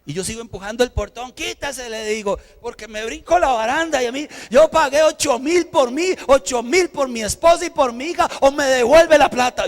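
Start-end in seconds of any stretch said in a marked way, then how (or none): 1.3-9.7 high, loud furious demand; angry shout. Retold or imitated. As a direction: yell at the ride attendant. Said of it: "Yo estaba enojado".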